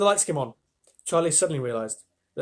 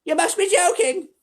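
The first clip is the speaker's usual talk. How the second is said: put-on high, squeaky old woman's voice, louder; Grandma Georgina; 7 dB louder than usual. A narrator's reading. Cranky grandmother scoffing at Wonka, high and squeaky. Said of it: "said Grandma Georgina".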